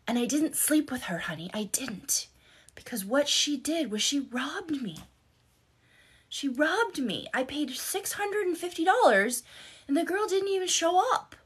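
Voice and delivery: high-pitched mock excuse; imitated protesting husband. Husband confessing to his wife on the phone, imitated high-pitched protest.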